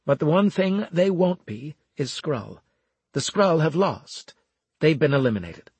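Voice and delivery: high, forceful pronouncement; emphatic officer. Acted. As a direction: lay down the verdict firmly.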